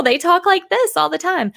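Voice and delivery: high-pitched, excited chatter; energetic and excited. Shown as a demonstration. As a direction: talk like a very energetic person. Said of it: "very energetic and excited people".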